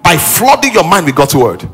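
high, loud sermon delivery; impassioned preaching. Real, not acted. Preacher urging worried believers into God's word, impassioned. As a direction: preach it with rising fervour, pitched high.

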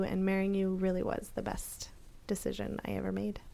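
tender whisper. Real, not acted. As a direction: breathe it softly to your wife. Tender anniversary words to wife.

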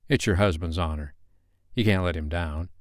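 ordinary delivery

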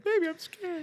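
very high, tiny scared voice; scared baby voice. Retold or imitated. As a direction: whimper it like a frightened child. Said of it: "you started talking like".

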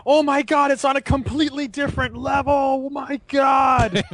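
high, hammed-up awestruck exclamation; shocked amazement. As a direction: gush in mock astonishment, stretching the final cry. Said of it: "everyone's shocked".

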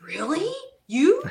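loud, high rising questions; incredulous. Retold or imitated. Other girl hearing she is nervous, incredulous. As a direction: echo disbelief, each word jumping up. Others react to her confessed nerves.

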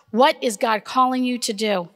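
ordinary talk

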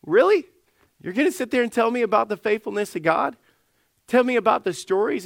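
high-pitched, disbelieving comeback; incredulous challenge. Acted. Doubter told about the faithfulness of God, incredulous. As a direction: start on a high rising note, then keep challenging.

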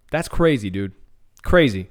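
ordinary delivery

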